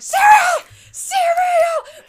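very high, loud excited yell; blurting a word out at a school assembly, loud shout; blurted shout. Retold or imitated. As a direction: shout it across the auditorium. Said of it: "From all the way across the auditorium".

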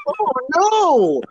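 high, drawn-out, hammed-up "oh no"; badly faked dismay. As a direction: overact dismay like a terrible actor. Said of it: "acting was bad".

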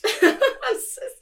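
high and loud, words broken by laughter; laughing. Real, not acted. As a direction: burst out laughing as you start talking. Guest laughs at being caught.

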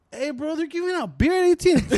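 mock bro announcing free beer; hyped-up dude. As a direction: play the excited neighbour guy. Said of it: "He's like".

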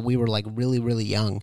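normal talking voice

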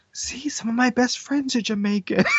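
high-pitched put-on defensive voice; defensive excuse-maker. Friend parodies someone excusing his accent, high defensive voice. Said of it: "do the other route where it's just like".

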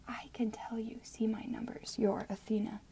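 soft, coy whisper. Acted. The plague whispering coyly at the window. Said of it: "whispering coyly".

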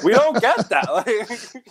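slow, laughing through words. Real, not acted. Laughing while speaking.

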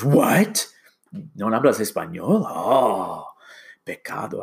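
a lightly voiced scandalized Spanish speaker; mock shocked relative. Someone shocked a Mexican-heritage kid can't speak Spanish, lightly mock scandalized.

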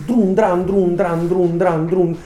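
loud high rhythmic punch noises; mouth noise. Shown as a demonstration. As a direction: drum out the blows with your mouth, loud and high. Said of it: "rumore con la bocca".

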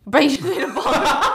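laughing through the words at a friend's rice ball; cracking up mid-tease. Real, not acted. Friend's failed rice snack.

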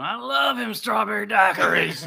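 put-on loud, slow, drunken slur; drunk father-in-law. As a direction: play the tipsy father-in-law. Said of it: "He just kept going".

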